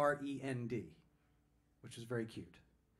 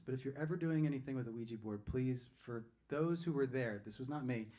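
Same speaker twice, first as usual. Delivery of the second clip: normal talking voice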